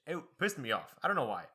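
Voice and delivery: ordinary talk, nothing marked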